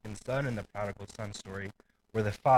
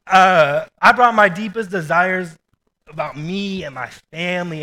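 fervent; 7 semitones higher, 12 dB louder than usual. Real, not acted. Fervent preaching peak.

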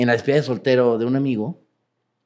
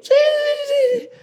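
excited shouted yes; slower than usual. Real, not acted. Confirming to the host he really watched, an excited shout. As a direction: shout the yeses back, giddy.

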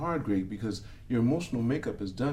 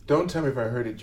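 normal talking voice

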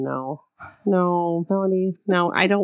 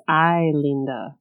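low, slow Spanish; 5 semitones lower, slower than usual. Retold or imitated. Retelling actor's Spanish line.

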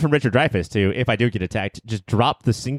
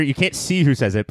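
ordinary talk, nothing marked

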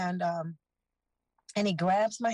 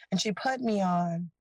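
ordinary talk, nothing marked

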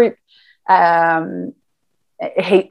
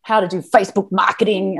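normal talking voice

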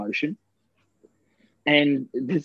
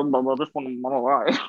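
mumbled mockery. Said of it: "what he was saying".

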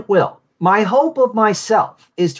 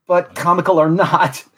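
lightly voiced fussy Niles; a hint of Niles Crane's fussy lament; Niles Crane impression; 4 semitones higher than usual. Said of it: "his great, uh, impressions".

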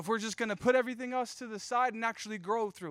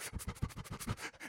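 imitated gasping cry, voiceless; stuttering little breaths, as if crying too hard; mock quivering sobs; 9 dB quieter than usual. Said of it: "cry so much you get asthma".

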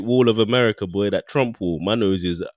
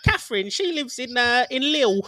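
much higher, slow put-on tourist voice with laughter; mock tourist; slower than usual. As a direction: ham up a tourist meeting locals. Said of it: "you just meet your cousins".

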